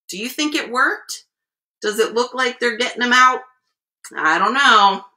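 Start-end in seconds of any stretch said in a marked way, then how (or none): none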